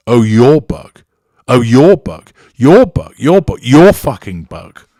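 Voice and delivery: loud sneering mockery, rising high on the repeats; belittling, threatening. Narcissist taunting the victim over her torn book, loud mocking yells. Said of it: "issues the narcissist, belittling and threatening".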